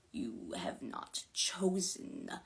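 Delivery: low, slow, whispery menace; hushed, drawn-out ghost voice; possessing spirit. A narrator's reading. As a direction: speak softly as a cold otherworldly spirit. Possessing spirit answers Piper.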